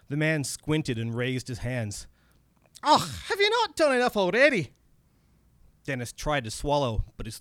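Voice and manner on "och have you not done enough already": high-pitched, exasperated Scottish voice; Scottish accent. Acted. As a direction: complain as an irritated little Scotsman. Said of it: "my bad Scottish accent comes in now".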